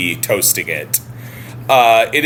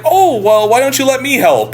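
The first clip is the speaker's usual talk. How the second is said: much higher, louder, hammed-up helpful offer; Larry eagerly offering to cut the doll's hair; mock eager Larry; 13 semitones higher, 4 dB louder than usual. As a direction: overdo the friendly offer to help.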